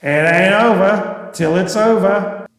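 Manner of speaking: put-on a light nod to an old baseball sage; lightly voiced folksy baseball legend; Yogi Berra. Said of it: "as baseball legend Yogi Berra is famously reputed to have said".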